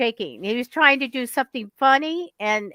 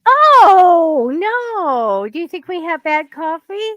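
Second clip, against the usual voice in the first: much higher, loud drawn-out "oh" easing off; high-pitched, taken-aback protest; playful dismay; 8 semitones higher, 8 dB louder than usual. Real, not acted. American reacting to a jibe about bad coffee, playful dismay.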